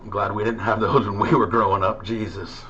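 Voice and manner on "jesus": usual speaking voice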